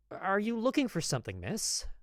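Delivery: quick nervous offer of help; hurried. Acted. Nervous apothecary greeting the lady, hurried and high. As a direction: rush the question out, flustered. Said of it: "I hurried to ask her".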